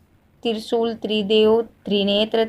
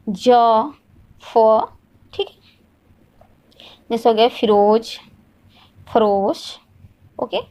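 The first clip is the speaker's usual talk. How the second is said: ordinary delivery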